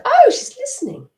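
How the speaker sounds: a startled little inner voice noticing it is heard; surprised inner voice. Retold or imitated. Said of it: "Your inner voice is gonna go".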